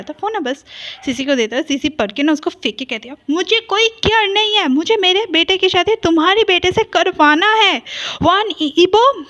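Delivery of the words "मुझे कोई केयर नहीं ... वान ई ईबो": angry demand. A narrator's reading. Sister throws file, demands wedding.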